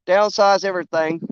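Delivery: ordinary delivery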